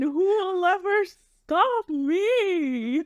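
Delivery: slow theatrical villain menace. Pretend. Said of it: "I'll pretend like I'm a villain".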